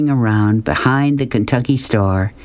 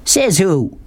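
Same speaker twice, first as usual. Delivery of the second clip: higher, indignant challenge; mild anger, pitched up; suddenly angry; 8 semitones higher than usual. A narrator's reading. Man challenges boy holding broom, suddenly angry. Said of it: "suddenly angry".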